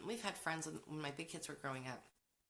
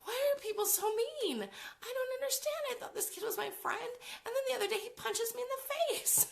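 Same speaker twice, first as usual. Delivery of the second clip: much higher, louder mock voice of the hurt teenage son; imitating upset teen son; 5 dB louder than usual. Mom mimics her son's message about being punched. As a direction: squeak it out as the bewildered boy. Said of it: "he would message me and be like".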